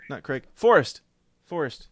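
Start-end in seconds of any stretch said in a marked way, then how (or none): 0.6-1.0 loud call to get his attention. Real, not acted. Host calling the caller's name.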